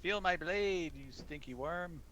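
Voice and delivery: high, loud mad cackle into a taunt; cackling madly. Acted. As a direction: cackle like a crazed genie mid-fight. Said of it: "he's kinda cackling madly as he goes about it".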